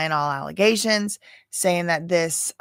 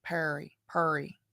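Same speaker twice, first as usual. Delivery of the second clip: "Perry" in a Georgia accent, the vowel blurred into an r, "Purr-ee"; soft, low Georgia "Perry", the e swallowed into r; Georgia way of saying Perry; 3 semitones lower, 10 dB quieter than usual. Shown as a demonstration. Showing chat how a Georgia local says the name "Perry", Georgia accent. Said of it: "It sounds like a very Georgia".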